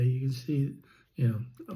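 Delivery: ordinary talk, nothing marked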